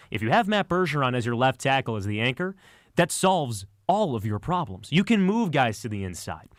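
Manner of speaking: ordinary delivery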